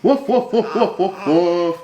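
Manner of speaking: put-on high, playful woof woof for a baby; playful dog barks. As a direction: bark like a happy puppy to make him giggle. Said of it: "I have a dog who goes".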